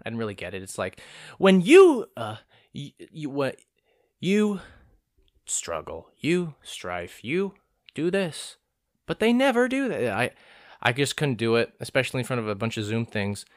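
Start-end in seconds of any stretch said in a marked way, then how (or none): 1.4-10.1 ad read attempt. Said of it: "one that was kind of, like, a ad".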